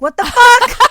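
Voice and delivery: loud, high-pitched disbelief; shocked outburst. Retold or imitated. Viewer reacting to the double homicide remark, shocked outburst. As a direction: burst out incredulously at full volume.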